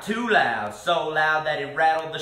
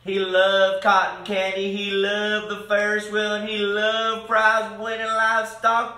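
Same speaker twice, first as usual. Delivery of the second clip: usual speaking voice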